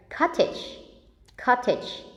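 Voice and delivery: "Cottage" with the weight on COT; stress on first syllable. Shown as a demonstration. Teacher models stress on the first syllable of "Cottage". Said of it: "the stress is on the first syllable".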